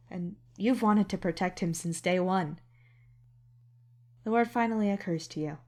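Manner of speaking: ordinary talk, nothing marked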